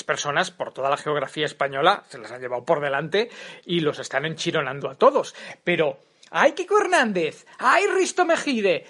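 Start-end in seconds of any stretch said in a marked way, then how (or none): 5.6-8.9 mock scolding. Mocking the TV critics.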